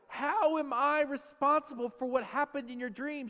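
high, loud mock disbelief; exasperated retold question; incredulous husband. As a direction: protest the unfairness, acting it out. Retold husband argues with wife.

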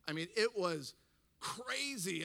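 ordinary talk, nothing marked

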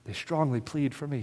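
ordinary talk

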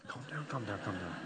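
quiet aside to self; calming himself. Real, not acted. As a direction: murmur to settle yourself. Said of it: "I'm getting excited".

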